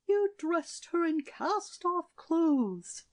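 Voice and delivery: high, slow reproach from the queen; accusing queen. A narrator's reading. Queen accusing the shepherdess, high and slow. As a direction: let the queen's accusation sting. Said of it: "said the queen".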